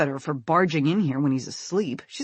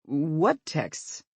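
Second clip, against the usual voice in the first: playing dumb. A narrator's reading. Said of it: "he says stupidly".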